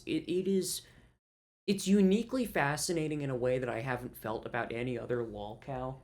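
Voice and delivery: usual speaking voice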